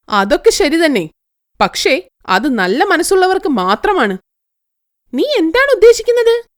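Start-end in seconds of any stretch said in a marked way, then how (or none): 5.1-6.4 high, eager voice asking the magic tree; greedy young woman. Acted. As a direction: pitch it up as the demanding girl. Girl questions the tree. (ഉയർന്ന സ്വരത്തിൽ ആർത്തിയുള്ള പെൺകുട്ടി)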